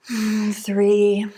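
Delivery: normal talking voice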